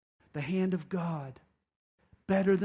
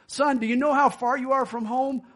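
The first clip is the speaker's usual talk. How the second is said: louder, higher kindly grandpa voice; grandpa asking; 7 semitones higher, 8 dB louder than usual. Retold or imitated. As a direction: play the old grandfather asking the boy.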